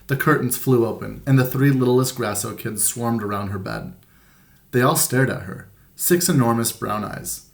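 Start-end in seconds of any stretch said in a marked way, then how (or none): none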